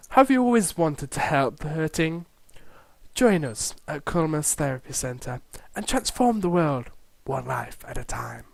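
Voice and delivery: soft, low, gentle pitch; soothing therapist. Acted. Therapist inviting recruits to the centre, soothing.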